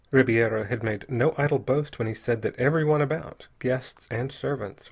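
usual speaking voice

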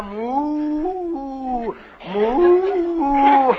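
long, slow mooing; cow moo. Pretend. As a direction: draw out a lazy cow moo. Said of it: "Now listen to the cow".

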